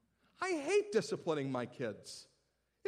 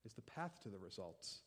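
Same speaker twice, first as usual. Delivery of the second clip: soft echo; 6 semitones lower, 12 dB quieter than usual. Shown as a demonstration. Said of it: "repeated softer".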